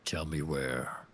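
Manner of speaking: low, slow looming threat; low orc menace. Acted. Orc pressing the character for an answer, low menacing voice. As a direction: loom over the little man.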